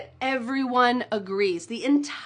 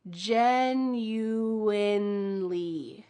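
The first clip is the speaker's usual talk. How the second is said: genuinely stretched slowly with a clear N in the middle; teacher slow demo of genuinely; very slow and careful; much slower than usual. Shown as a demonstration. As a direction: draw out genuinely, landing on the middle N. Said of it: "There is an N in the middle of this word".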